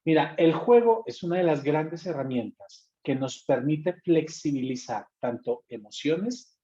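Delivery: ordinary delivery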